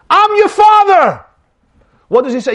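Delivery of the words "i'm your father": loud, high-pitched proclamation; acted exclamation, pitched way up; dramatic declaration. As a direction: announce it like a big revelation. Man announces himself to adopted boy.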